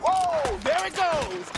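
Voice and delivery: startled yell as it blows; excited shout. Acted. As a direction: shout over the blast. Explosion during gunfight.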